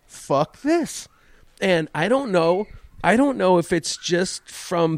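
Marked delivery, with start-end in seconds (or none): 0.0-1.1 inner fed-up. Retold or imitated. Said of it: "my head just says".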